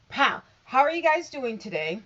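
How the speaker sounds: loud, high energetic hello; excited greeting. Real, not acted. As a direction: burst in with big energy.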